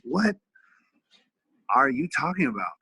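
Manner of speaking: retold disbelief, pitched high; a high, loaded "what", then the question; incredulous. Dad answering son's wish to wrestle, incredulous.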